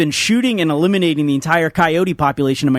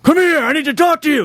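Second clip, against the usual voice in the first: put-on old man yelling; 11 semitones higher than usual. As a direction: holler it across from the garage. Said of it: "just yells out".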